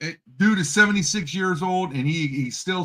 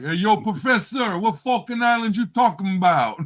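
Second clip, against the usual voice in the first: put-on New York accent; 3 semitones higher, 4 dB louder than usual. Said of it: "thick New York accent".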